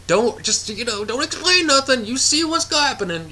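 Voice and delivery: a high-voiced plea, mock talking to the character; high pleading advice. Reviewer urging the film's kid, high and pleading. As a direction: pitch jumps way up, urging the kid to just watch.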